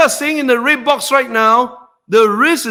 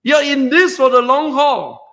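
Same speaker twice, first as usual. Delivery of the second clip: ordinary delivery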